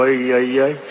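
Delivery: low groaning ay ay ay; a hammed-up low groan of dread; mock dismay. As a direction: wince as if afraid of him.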